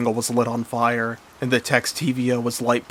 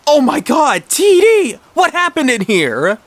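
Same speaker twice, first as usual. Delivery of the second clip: very high, loud cry; acted shrieking alarm; horrified parent; 6 dB louder than usual. A narrator's reading. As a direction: cry out in shock at the wreckage. Dad finds the explosion.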